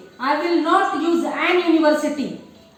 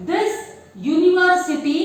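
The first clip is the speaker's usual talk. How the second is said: usual speaking voice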